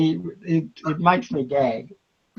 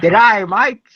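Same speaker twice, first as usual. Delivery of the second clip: Australian greeting; 3 semitones higher, 5 dB louder than usual. Pretend. Answering if he speaks Australian.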